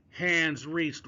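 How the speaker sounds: ordinary delivery, nothing marked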